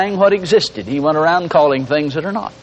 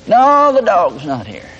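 drawn-out, higher mock protest; mock stubborn complaint; 4 semitones higher, slower than usual. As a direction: wail the objection slowly, hammed up. Stubborn complainer answers the neighbor.

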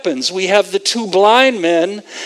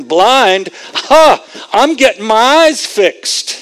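loud, higher-pitched, insistent resolve; determined; 3 semitones higher, 4 dB louder than usual. Acted. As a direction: act out someone set on getting healed, voice raised. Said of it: "So they were determined to get something".

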